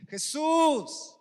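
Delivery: loud, high pleading shouts; drawn-out, full-voiced cry for help. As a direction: call out for mercy over a crowd. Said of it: "comenzó a dar voces".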